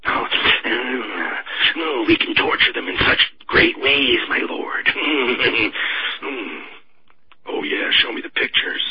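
breathy, unvoiced moaning delight; hammed-up creepy pleasure with moans; mock lustful relish. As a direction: play a war hawk getting off on cruelty. Mocking hawks as aroused by torture.

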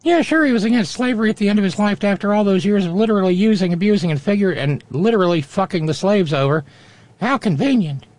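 put-on sarcastic squeaky little boy; high kid puppet voice. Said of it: "Oh, no, Junior heard that".

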